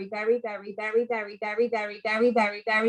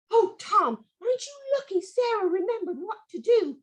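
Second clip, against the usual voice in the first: higher lightly voiced little sprite; cheerful little hedge sprite; 9 semitones higher than usual. Hedge sprite teasing the rescued boy, higher voice. Mister Brambles speaks.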